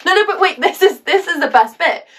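high, loud, excited interruption; eager storytelling. Acted. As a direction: jump in eagerly before they lose interest. Eagerly holds listener for best bit.

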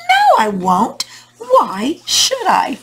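stretched-out, swooping refusal from the fire; storybook fire saying a drawn-out no; defiant refusal. Acted. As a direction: refuse haughtily, drawing out "why should I".

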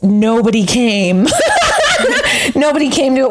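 words breaking into real laughter; laughing. Real, not acted. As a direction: laugh through the admission, pitch jumping up. Laughing at failed first show.

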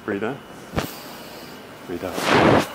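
low, soft coaching voice for breathing; low soothing stretch coach; calm breathing cues. Acted. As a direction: guide the client through each breath, softly.

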